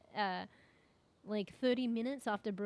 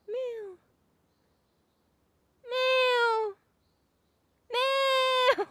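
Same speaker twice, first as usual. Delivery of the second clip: high, loud wailing meows; imitating the cat; 14 semitones higher, 11 dB louder, much slower than usual. Her cat wailing outside the bathroom door, high loud mock meows. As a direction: mimic a cat yowling at the door, drawn out. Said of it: "and I hear this".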